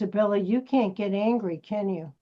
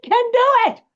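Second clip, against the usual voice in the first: much higher, louder mock sleep-talker; sleep-talking mock; 14 semitones higher, 10 dB louder than usual. Director imagining the actress sleep-talking, much higher and louder. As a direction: shriek it like she's dreaming it. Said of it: "in the middle of her sleep go".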